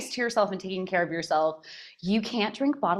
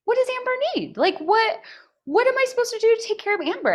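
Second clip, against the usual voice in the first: high, wondering voice; wondering aloud; 13 semitones higher, faster than usual. Retold or imitated. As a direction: ask yourself in wonder. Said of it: "I began asking".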